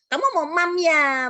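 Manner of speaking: put-on high, loud, slow cooing to a toddler; parental baby talk. Parent asking a toddler about food, exaggerated baby talk. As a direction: copy a toddler's cadel talk, drawn out. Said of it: "kita orang tuanya ikutan".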